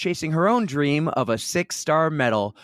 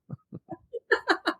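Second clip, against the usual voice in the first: high, breathy laugh; a quick high laugh; 6 dB quieter than usual. Real, not acted. Laughs at own joke.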